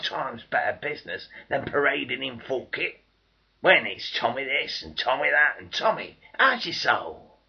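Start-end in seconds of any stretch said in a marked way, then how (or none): 4.1-7.4 louder, higher taunt, slowing at the end; loud sneering taunt; mocking jeer. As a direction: jeer it at him. Mocking civilians jeering.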